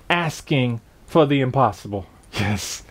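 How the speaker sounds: dropped to a near-whisper, low and soft; hushed. Real, not acted. Preacher hushing the congregation on his key point, soft and low. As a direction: lean in and say it softly, as a secret.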